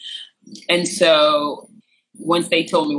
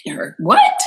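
very high, louder disbelief; stunned repeating; 5 dB louder than usual. Retold or imitated. Repeating the slur back in a haze, stunned disbelief. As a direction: reenact a shocked echo, voice shooting up. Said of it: "in a little bit of a haze".

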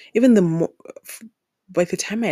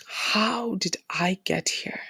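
whispered disbelief; 6 dB quieter, slower than usual. Retold or imitated. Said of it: "whispered self-question".

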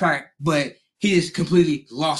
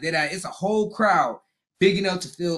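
ordinary delivery

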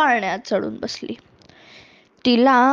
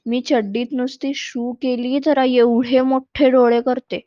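plain reading voice

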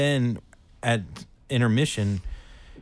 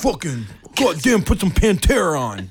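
louder, higher mock-aggressive bark; aggro coke dude; 6 semitones higher, 4 dB louder than usual. Storyteller playing up his aggro bro self, loud mock demand. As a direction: play along as the wired bro. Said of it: "the aggro coke dude".

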